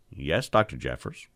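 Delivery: low, soft, obedient answer; acted cowed trainee; meek reply. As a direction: submit quietly to the stern surgeon. Trainee meekly answers stern surgeon.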